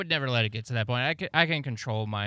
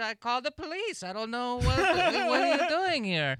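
high, mock Italian accent, rising at the end; a guy imitated with an Italian accent, half laughing; high-pitched Italian-accented man, caught between laughing and yelling; 12 semitones higher than usual. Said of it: "had, like, a Italian accent kind of".

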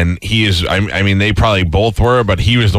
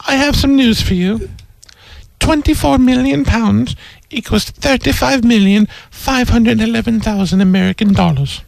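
put-on posh English accent; 10 semitones higher than usual. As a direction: announce the news in a plummy English accent. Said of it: "I might talk like this".

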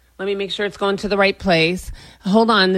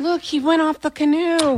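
higher-pitched feigned surprise; fake innocent dismay; 7 semitones higher than usual. Partner pretending he fell off the canoe, mock innocent. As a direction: play innocent, badly faked.

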